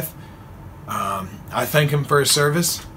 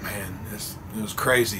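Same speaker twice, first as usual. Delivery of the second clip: hushed, much softer mock-solemn reply; put-on solemnity to keep the lie going; fake solemn; 5 semitones lower, 9 dB quieter than usual. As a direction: play it grave like a fellow veteran. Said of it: "I'm trying to act all solemn".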